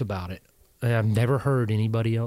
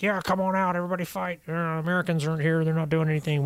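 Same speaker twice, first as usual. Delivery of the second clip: higher, lightly voiced militia leader calling all out; hammed-up rallying cry; mock Sadr rallying; 6 semitones higher than usual. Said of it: "apparently Al Sadr's like".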